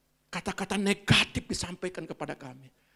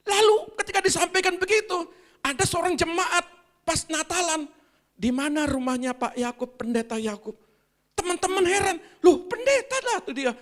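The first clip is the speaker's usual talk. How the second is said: excited telling; 12 semitones higher, 7 dB louder than usual. Acted. Excited storytelling in sermon.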